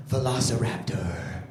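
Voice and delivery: low, drawn-out creature-feature voice; mock-dramatic. Dad naming his son's favorite game, low and dramatic. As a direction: drop deep and stretch the name.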